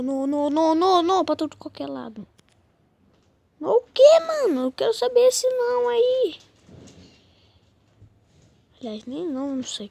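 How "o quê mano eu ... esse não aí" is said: high, loud indignant exclamation; outraged surprise. Real, not acted. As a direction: demand an answer in disbelief. Indignant at the other players. (surpresa indignada, alta e aguda)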